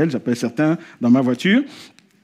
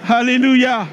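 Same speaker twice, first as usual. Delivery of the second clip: fervent preaching; 8 semitones higher than usual. Acted. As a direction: rouse the crowd and make them repeat it. Preaching at full force.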